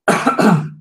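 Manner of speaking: another cough after choking on a drink; coughing up water. Real, not acted. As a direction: clear the throat with a cough. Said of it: "went down the wrong pipe".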